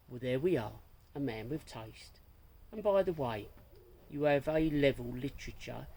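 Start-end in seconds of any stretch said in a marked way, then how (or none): none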